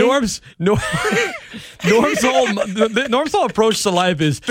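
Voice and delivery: talking through real laughter; high, loud laughing words. Real, not acted. Laughing while teasing co-host.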